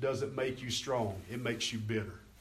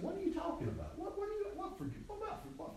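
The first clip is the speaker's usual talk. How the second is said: high, soft falsetto sputter; baffled mock reaction; 7 dB quieter, faster than usual. Preacher mimics someone told they are forgiven, baffled. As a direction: imitate a flustered, stammering person in a tiny high voice.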